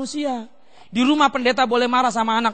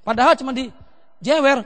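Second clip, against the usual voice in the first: normal talking voice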